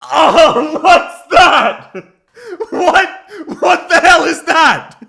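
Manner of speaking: high, loud laughing disbelief; appalled laughter. Real, not acted. Streamer hearing an awful accent clip, appalled and laughing loudly. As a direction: cry out aghast through real laughter.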